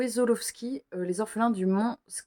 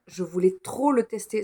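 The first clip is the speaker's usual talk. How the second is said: ordinary delivery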